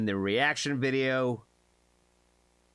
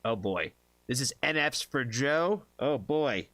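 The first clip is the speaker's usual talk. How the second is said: ordinary delivery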